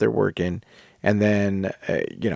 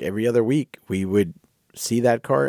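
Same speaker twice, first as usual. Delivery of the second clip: ordinary delivery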